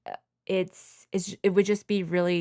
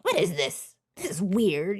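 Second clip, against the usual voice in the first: mom grumbling. Retold or imitated. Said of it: "It's like".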